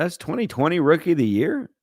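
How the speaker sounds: ordinary talk, nothing marked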